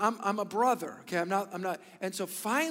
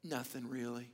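sheepish reply; 7 semitones lower, 10 dB quieter than usual. Retold or imitated. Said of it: "I said".

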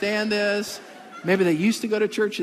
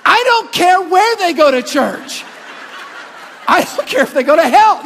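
much higher, much louder shout through laughter; mock outburst; 10 semitones higher, 11 dB louder than usual. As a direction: blow up as a joke, laughing. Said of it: "Okay, that was too much".

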